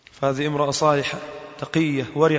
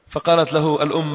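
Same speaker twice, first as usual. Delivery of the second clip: usual speaking voice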